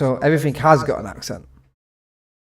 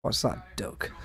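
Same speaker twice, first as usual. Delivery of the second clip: whisper; 6 semitones lower, 14 dB quieter, slower than usual. Real, not acted. Teasing the duck in game.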